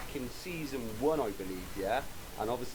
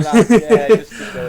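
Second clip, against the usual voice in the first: laughing through the words; 8 semitones higher, 19 dB louder, slower than usual. Real, not acted. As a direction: laugh it out while agreeing. Laughing at co-host's joke.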